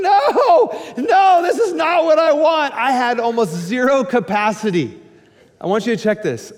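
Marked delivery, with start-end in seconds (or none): 0.0-2.7 high laughing protest. Retold or imitated. Pastor as neighbor girl grabs baby, laughing protest.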